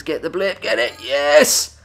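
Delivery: rising excitement to a triumphant yes; excited. Real, not acted. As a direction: cheer as the blip is grabbed. Tense gameplay then success.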